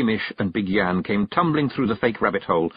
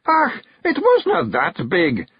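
much higher, louder slow whimpering excuse; desperate; 4 dB louder, slower than usual. Acted. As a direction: squirm out of it. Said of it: "desperately looking around".

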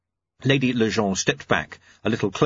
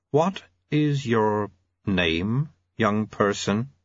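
very slow, measured auditor; ponderous, deliberate official; much slower than usual. Acted. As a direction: question him slowly and formally.

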